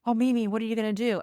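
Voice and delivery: lightly acted child's anxious question, quick and high; worried grandson. Grandson asks his grandmother during a police stop, worried. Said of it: "my grandson was saying".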